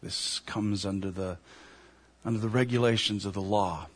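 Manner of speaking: softly, low and regretful, as the priest; very quiet voice. Acted. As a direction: deliver the bad news in a low, hushed voice. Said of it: "says in a very quiet voice".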